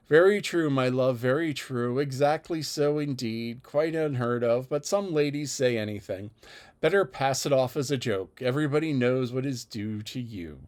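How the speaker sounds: usual speaking voice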